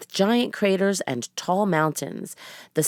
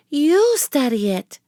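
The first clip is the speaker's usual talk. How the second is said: put-on high, slow and louder girl; a bold little girl voice; 9 semitones higher, 4 dB louder than usual. A narrator's reading. Narrator voices Annie.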